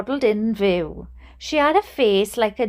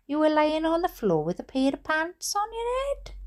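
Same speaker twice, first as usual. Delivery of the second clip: softer, higher teasing question; slight smirk; 5 semitones higher, 5 dB quieter than usual. A narrator's reading. Dad querying aunt's pants story, slight smirk. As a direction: ask with a small smirk in the voice. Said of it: "with something approaching a small smirk".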